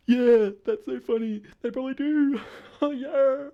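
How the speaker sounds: high goofy character voice, laughing at the end; silly high voice. Acted. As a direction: squeak it out like a cartoon bro. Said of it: "I don't know if you can hear that voice".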